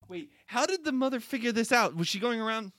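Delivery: loud, high, rapid questioning; incredulous. Real, not acted. Listener interrupts reader over tree-tasting mom, loudly incredulous. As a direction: burst in baffled.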